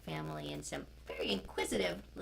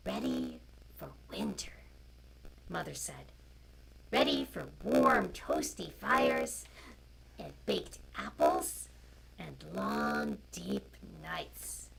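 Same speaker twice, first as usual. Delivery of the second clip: loud, slow storybook reading; reading to children; 2 semitones lower, 6 dB louder, slower than usual. A narrator's reading. Storyteller reading a picture book to children online. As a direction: read it out to the little ones, clearly.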